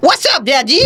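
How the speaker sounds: high-pitched mock British child; exaggerated British kid greeting; ridiculous British accent. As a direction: put on a silly British accent, amused. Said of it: "ridiculous British accent".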